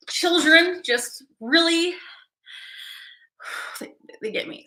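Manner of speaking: touched, slowing and sinking to very soft; a real touch of being choked up; emotional. Real, not acted. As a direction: let the voice drop away with feeling. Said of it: "I get very emotional".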